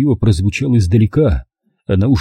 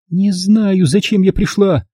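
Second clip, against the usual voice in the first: plain reading voice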